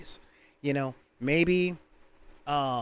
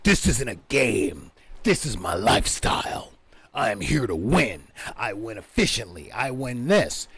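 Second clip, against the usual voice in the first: lower, stern mock tryhard; imitating a serious player; 4 semitones lower than usual. Hardcore tournament player declaring he wins, lower and stern. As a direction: lightly play the grim tournament player. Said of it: "hardcore players that are like".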